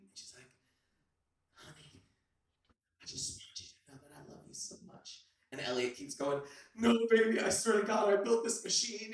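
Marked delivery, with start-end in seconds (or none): 1.5-5.3 faint, breathless whisper from a sickbed; dying whisper. Acted. Dying girlfriend in hospital bed. 6.7-9.1 desperate pleading. Acted. Elliot pleading at her bedside.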